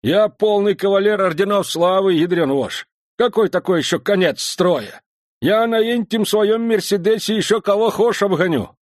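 put-on loud, high, cocky old man; boastful old veteran. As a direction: brag like a feisty veteran.

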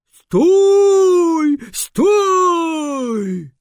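desperate high shout to stop; shouting orders over battle. Acted. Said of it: "расслышал крик Бобра Бобровича". (отчаянный громкий крик)

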